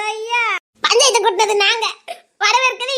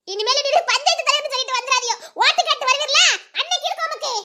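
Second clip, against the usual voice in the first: fast, higher, heated rant; angry scolding; 5 semitones higher, much faster than usual. Acted. Mother berating the panchayat leader for siding with them. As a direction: tear into the village head.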